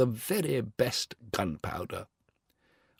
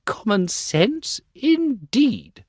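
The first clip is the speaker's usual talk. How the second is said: scoffing, slow, rising high on indeed; indignant; 8 semitones higher, 3 dB louder, much slower than usual. Acted. The rocket scoffs at the Bengal light, indignant and high. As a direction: sneer the phrase back, offended. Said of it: "said the rocket indignantly".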